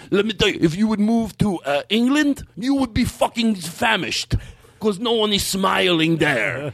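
high accented impression, slowing indignantly; imitated acquaintance's rant. Man lectures customer on moving away, high indignant imitated voice. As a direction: go high, then drag the punchline out. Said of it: "do him doing that voice".

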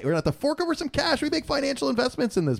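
high-pitched comic protest; mock indignation. Host protesting the cost of podcasting, mock indignation. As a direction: plead your case in a very high voice.

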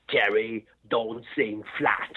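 put-on German accent. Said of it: "imagine Arnold Schwarzenegger saying that with that kind of voice".

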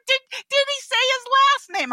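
incredulous question. Retold or imitated. Friends incredulous.

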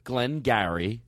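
"Glengarry" low, as "glen-garry"; coaching pronunciation. Shown as a demonstration. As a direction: model "Glengarry" distinctly with a rise at the end. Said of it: "hold on".

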